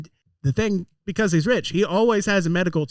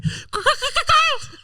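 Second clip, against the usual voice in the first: sugar glider bark. Acted. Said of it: "Just like the sugar glider sounds".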